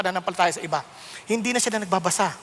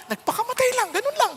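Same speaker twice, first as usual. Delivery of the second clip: pitched way up in shock, higher; shocked disbelief; 12 semitones higher than usual. Real, not acted. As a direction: say it stunned, voice climbing high. Shocked retelling of a suicide.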